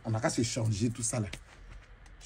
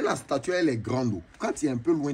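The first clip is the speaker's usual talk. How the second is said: ordinary talk, nothing marked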